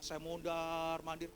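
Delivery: slow, stretched-out mondar-mandir; long weary-paced vowels; drawn-out storytelling. Retold or imitated. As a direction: drag the vowels to mimic endless pacing. Acts out pacing while waiting.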